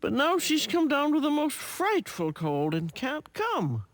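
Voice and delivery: usual speaking voice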